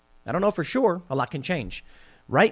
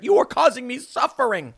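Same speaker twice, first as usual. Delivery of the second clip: high, louder whiny complaint; mock whining; 8 semitones higher, 4 dB louder than usual. Entitled stranger demanding your shirt, mock whine. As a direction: whine like a spoiled taker.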